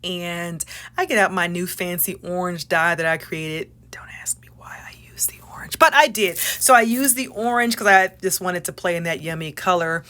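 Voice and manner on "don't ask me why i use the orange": quick soft unvoiced aside; sheepish whisper. Real, not acted. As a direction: whisper it like a confession. Aside about her dye choice.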